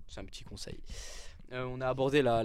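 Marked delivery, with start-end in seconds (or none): 0.1-0.8 unvoiced, softer little tip; hushed afterthought; quiet aside. Real, not acted. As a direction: drop to a near whisper. Aside after joke advice.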